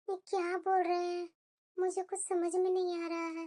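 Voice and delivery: soft, low, to yourself; confused muttering. Acted. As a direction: murmur it privately, baffled. Wife puzzles aloud to herself.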